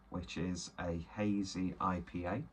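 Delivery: ordinary talk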